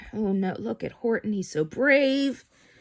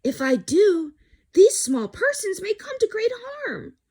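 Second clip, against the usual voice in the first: higher, louder alarmed Horton; alarmed elephant; 11 semitones higher, 3 dB louder than usual. Acted. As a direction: worry rising into alarm. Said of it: "Horton thought with alarm".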